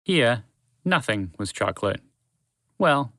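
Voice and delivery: ordinary delivery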